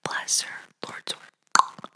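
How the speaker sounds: hushed unvoiced whisper of thanks; whispered blessing. Listener whispering thanks after hearing the podcast. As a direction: breathe it like a grateful prayer. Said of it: "she's gonna listen and go".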